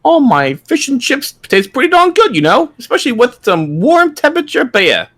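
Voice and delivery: put-on high, hammy British accent; mock British accent. Cohost joining the joke, in a high mock British accent. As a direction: play a jolly cartoon Brit.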